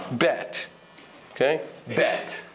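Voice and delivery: "Bet" with short English e; crisp English "Bet", ending in t; English pronunciation. Shown as a demonstration. Teacher modelling an English word, short-vowel bet. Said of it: "This is an English word, bet".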